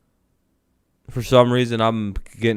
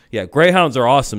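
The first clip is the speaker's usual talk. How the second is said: ordinary talk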